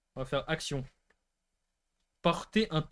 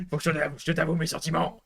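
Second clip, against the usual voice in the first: rock voice love declaration. Shown as a demonstration. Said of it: "d'une voix rock".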